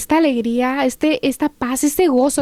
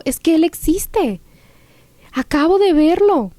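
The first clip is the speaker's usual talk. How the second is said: higher, amazed insistence; emoción gozosa; 5 semitones higher than usual. Retold or imitated. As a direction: tell it excitedly, as fresh news. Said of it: "yo estaba con una emoción". (con emoción, voz más aguda)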